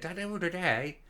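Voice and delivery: lightly mimicked lilting Highland ferryman accent; sing-songy accent. Recalling the Cape Wrath ferryman, a mild Highland sing-song. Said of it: "lovely sing-songy accent".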